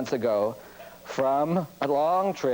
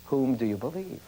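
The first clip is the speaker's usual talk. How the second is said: ordinary talk, nothing marked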